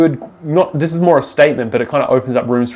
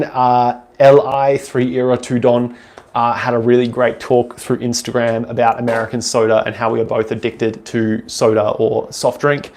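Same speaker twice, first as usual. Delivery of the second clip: ordinary talk, nothing marked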